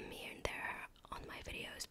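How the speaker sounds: usual speaking voice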